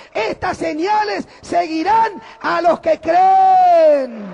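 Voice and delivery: loud, high shouting sermon, slow on the climaxes; fiery pulpit shout; preaching at full force. Acted. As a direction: thunder it to the congregation.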